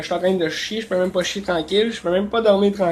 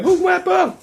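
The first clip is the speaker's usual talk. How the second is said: much higher, mock landlady voice; little woman's voice; 11 semitones higher than usual. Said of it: "une petite voix de femme". (imitation de la propriétaire avec une petite voix aiguë)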